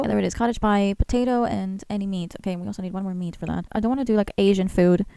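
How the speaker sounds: normal talking voice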